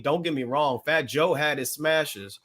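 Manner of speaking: usual speaking voice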